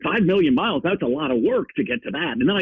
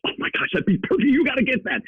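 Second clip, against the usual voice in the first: higher-pitched excited wife imitation; 10 semitones higher than usual. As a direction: retell her eager, high-pitched reaction. Wife acted reacting to job news.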